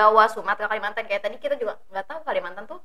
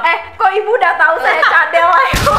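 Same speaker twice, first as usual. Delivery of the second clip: laughing; 7 semitones higher, 9 dB louder than usual. Real, not acted. Laughing at being found out.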